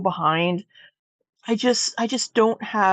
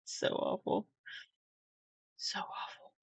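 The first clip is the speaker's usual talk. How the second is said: whispered; 15 dB quieter than usual. Real, not acted. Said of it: "whispered laughing repeat".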